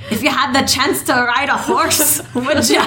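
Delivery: hammed-up Scottish accent, barely holding in laughs. A cohost offering a joke to a friend, mock Scottish accent. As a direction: put on a joking Scottish lilt. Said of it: "do my horribly offensive Scottish accent".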